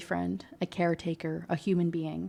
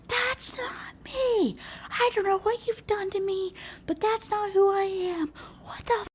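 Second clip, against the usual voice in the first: very high, strained squeal of panic; mock panic. Tied-up girlfriend shown the video, mock panicked squealing. Acting out tied-up character freaking out.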